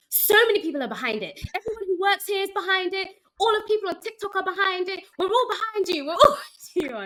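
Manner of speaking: passionate pitch. Retold or imitated. Waitress gushing to the app creator, enthusiastic. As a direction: sell it with delighted enthusiasm, laughing at the end. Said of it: "pitched at you so passionately".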